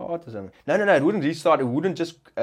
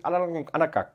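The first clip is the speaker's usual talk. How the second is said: garbled mumble; 3 semitones higher, 3 dB quieter than usual. Retold or imitated. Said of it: "I praat die ding".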